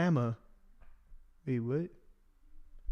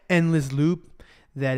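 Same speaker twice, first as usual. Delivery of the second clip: slowed down; 4 dB louder, slower than usual. Shown as a demonstration. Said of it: "I didn't wanna go too fast there".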